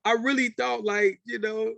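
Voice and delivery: giggly high voice, laughter running through the words; laughing while talking. Real, not acted. Recalling the six in the morning call, talking through laughter. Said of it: "I laughed again".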